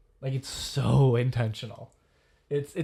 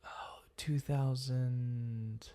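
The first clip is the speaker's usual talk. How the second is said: whispering; 9 dB quieter, much slower than usual. Real, not acted. Unvoiced aside.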